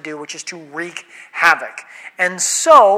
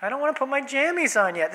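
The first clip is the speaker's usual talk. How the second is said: put-on higher, softer child's bedtime whine; protesting child; 6 semitones higher, 6 dB quieter than usual. Demons as kids stalling Mom at bedtime, high whiny protest. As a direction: plead like a kid stalling bedtime. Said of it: "They're saying, "Mom".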